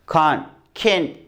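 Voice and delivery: "कांट" broad a, then "केंट" flat e; British vs American can't. Shown as a demonstration. Teacher contrasts British and American "can't". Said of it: "British बोलेगा डांस, American बोलेगा डेंस".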